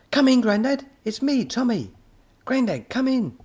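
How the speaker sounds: high, loud yell into a walkie-talkie. A narrator's reading. Boy yelling to Grandad over the walkie-talkie, high and loud. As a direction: shout it into the radio. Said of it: "Tommy yelled into his walkie-talkie".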